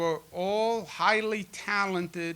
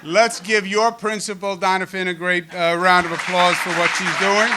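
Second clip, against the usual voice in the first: loud, raised podium voice; rally the room to clap, projecting over the crowd; big public cheer-leading call; calling for applause; 3 semitones higher, 11 dB louder than usual. Real, not acted.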